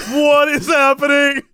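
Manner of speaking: high, drawn-out disbelief; bewildered outburst. Retold or imitated. Teller reliving his shock at the actress, bewildered. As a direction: stretch it out high in mock bewilderment. Bewildered reaction to odd rehearsal.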